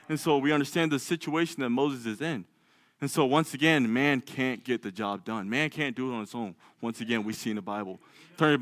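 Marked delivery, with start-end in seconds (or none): none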